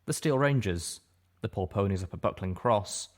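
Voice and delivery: ordinary delivery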